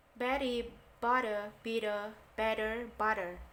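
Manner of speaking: T in "butter" and "better" softened into a quick D, American style; American flapped T. Shown as a demonstration. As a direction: say "bitter" with the T flapped to a soft D. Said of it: "kalau orang Amerika itu masuk ke T-nya, masuk banget ke D, soft D gitu". (T Amerika dibaca seperti D lembut)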